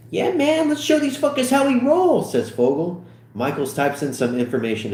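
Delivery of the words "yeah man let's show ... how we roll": high, excited cheer; pumped, high-pitched bravado; hyped up. Acted. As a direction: whoop it out, much higher. Acted hyped reply in script.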